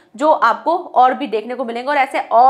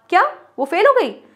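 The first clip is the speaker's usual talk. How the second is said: shocked question, pitched higher; higher, louder shocked gasp of a question; surprised; 6 semitones higher, 3 dB louder than usual. Shown as a demonstration. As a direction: react in disbelief to the news. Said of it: "आश्चर्य दिखा रहे हो".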